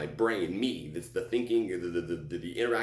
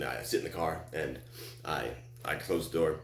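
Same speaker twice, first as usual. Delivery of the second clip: plain reading voice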